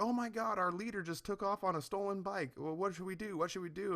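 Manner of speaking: put-on high, rushed, flustered girl voice; panicky girl. Teenage getaway driver frets to her friends, high and panicky.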